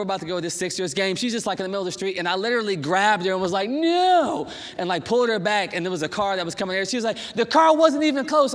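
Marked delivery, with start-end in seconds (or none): 3.7-4.5 retold high, loud "no" of alarm; alarmed shout. Grabbed wife stepping into traffic. 7.3-8.5 imitating wife's high, loud protest; indignant protest. Retells wife's protest after being grabbed.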